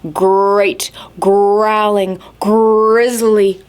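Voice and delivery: "Great, growling, grizzly" each g hit hard and slow; stressed g sounds. Shown as a demonstration. Teacher showing kids the alliteration, each g stressed. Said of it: "all the g, g, g sounds".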